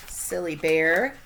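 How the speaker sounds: ordinary delivery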